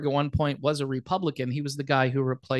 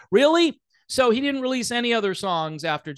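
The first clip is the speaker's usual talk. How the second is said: incredulous; 6 semitones higher than usual. Real, not acted. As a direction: question it with a sharp jump in pitch. Incredulous reaction to the article.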